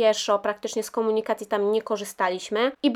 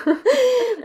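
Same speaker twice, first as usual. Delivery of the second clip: high, loud burst of laughter; 7 dB louder than usual. Real, not acted. Laughing about overheating laptop. (głośny, wysoki śmiech)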